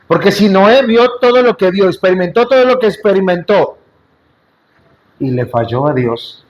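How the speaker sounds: ordinary talk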